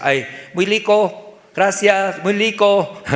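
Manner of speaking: "rico" pronounced "lico", like a foreigner; foreigner's L for R. Retold or imitated. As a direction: imitate a foreigner who cannot roll the R in "rico". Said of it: "extranjeros que no tienen, se pronuncian".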